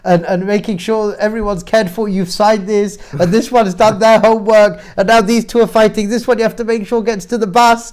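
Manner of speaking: harried and overwhelmed, playing it up; frantic parent. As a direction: pile chore on chore without let-up. Breathless list of morning chores.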